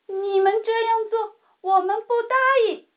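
high, firm, fast protest; loud protest. A narrator's reading. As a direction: stand up to the developers out loud. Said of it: "冲着开发商们大声". (冲着开发商大声抗议)

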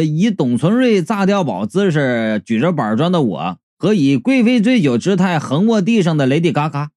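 plain reading voice

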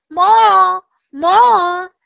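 loud, high, excited call; eager shout. Acted. Jack begs to go down again, loud and high.

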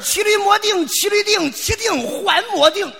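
high, loud proud declamation; hammed-up victorious recital; triumphant shout. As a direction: bellow the couplet in triumph. Triumphant repeat of couplet.

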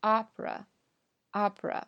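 opera as AAAH-pruh; stretched first syllable in a two-syllable opera; long stressed syllable. Shown as a demonstration. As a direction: draw out the O of opera. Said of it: "要拉得长一点".

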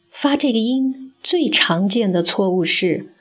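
ordinary talk, nothing marked

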